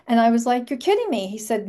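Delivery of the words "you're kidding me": high, stunned disbelief; can't believe the news; surprised disbelief. Retold or imitated. A stunned reply to her friend's news, pitched up high.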